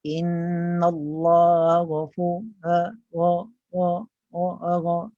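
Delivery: slow chant with GH weakened into WA; careless ghin. Shown as a demonstration. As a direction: let GHAFU slip into a loose WA. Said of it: "Dia semacam sekadar lalu". (ghin dibaca sambil lewa)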